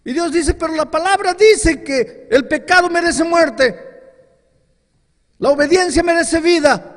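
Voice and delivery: loud, high, ringing pulpit voice; booming sermon delivery voicing God and sinner; full-force preaching. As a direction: preach it out with fire.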